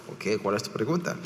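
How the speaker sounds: low, quick, softly tossed-off aside; retold teacher's answer, pitched well down; teacher's reply. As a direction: answer a student in a lightly voiced low murmur. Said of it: "yo como maestro".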